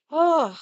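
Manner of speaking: loud, high "ugh" of real frustration; exasperated groan. Real, not acted. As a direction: let out a fed-up groan. Fed up after coffee back-and-forth.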